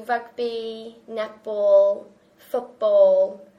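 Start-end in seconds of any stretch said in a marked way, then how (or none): none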